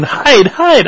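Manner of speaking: put-on high urgent child voice; imitating daughter. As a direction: squeak it out like an eager kid. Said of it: "my daughter's going".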